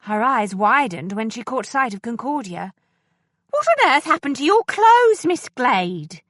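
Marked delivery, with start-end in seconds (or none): 3.5-6.2 high, loud gasp of dismay at the torn clothes; shocked housekeeper. A narrator's reading. Housekeeper shocked at Miss Glade's ruined clothes, high and loud. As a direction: exclaim it high and loud, scandalised.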